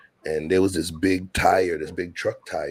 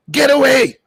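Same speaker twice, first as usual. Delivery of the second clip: shouted high Haitian mom yelling at cats; Haitian accent; 15 dB louder than usual. Retold or imitated. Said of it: "But she's like".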